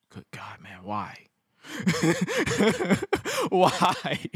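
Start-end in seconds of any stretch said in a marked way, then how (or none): 0.1-1.2 unvoiced, hushed disbelief; disbelieving whisper. Host reacting to JBL's remarks, stunned whisper. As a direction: whisper it like you can't believe it. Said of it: "I'm like".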